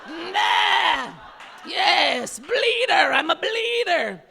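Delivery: high, loud, drawn-out cry; hammed-up shout. Comic proclaiming herself to the crowd, hammed-up shout. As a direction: holler it like a proud battle cry.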